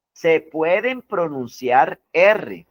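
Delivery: said slowly, every word of "Se pueden pronunciar R" weighted; slow emphatic rule. Shown as a demonstration. Teacher to students, repeating the key rule slowly. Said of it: "Miren que aquí dice".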